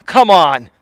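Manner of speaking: ordinary delivery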